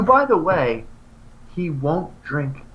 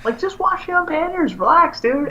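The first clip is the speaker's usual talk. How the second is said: mock exasperated. Retelling exasperated reply to friend.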